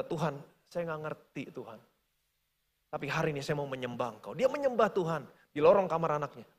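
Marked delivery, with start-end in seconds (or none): 0.0-4.3 softer, lower surrender; softened surrender. Retold or imitated. Frank softening his heart toward God, quiet. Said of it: "mulai melunakkan hatinya".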